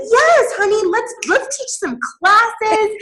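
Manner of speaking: high, sing-out enthusiastic cheer; hammed-up keen agreement; gushing eagerness. Acted eager reply to her assistant.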